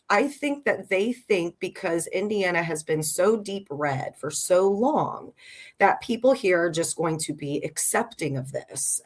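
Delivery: usual speaking voice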